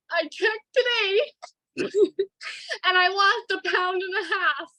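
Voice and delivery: high-pitched, tearful, breaking into a laugh; choked up with relief, slow at first then laughing through tears; real emotional. Real, not acted. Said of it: "I got a little emotional there".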